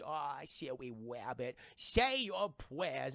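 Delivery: slow, menacing cartoon hunter lisp; Elmer Fudd impression. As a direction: drag it out like a smug hunter closing in. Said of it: "and he goes".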